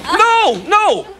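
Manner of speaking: high, urgent cry of protest; desperate plea. Acted. Alf as they say good night, desperately pleading. Alf stops them leaving.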